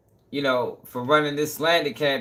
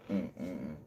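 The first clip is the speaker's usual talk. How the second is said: tired low hum; 10 semitones lower, 16 dB quieter than usual. Real, not acted. Worn out after a long day.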